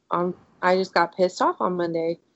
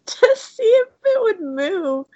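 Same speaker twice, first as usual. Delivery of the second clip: laugh-crying; 12 semitones higher, slower than usual. Real, not acted. Laughing through the story.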